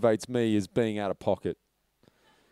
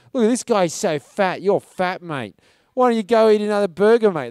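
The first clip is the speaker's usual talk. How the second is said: mock insulting; 6 semitones higher, 4 dB louder than usual. Drunk guy picking on the taxi driver actor, mock insulting. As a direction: imitate a heckler's jeering. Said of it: "he started insulting us".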